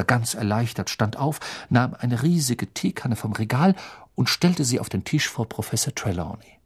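ordinary delivery, nothing marked

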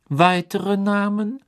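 kühl und langsam; kalt. Acted. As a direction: eisig fragen. Crouch fragt. (Crouch fragt Karkaroff kalt nach mehr)

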